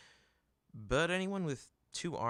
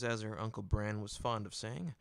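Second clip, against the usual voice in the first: usual speaking voice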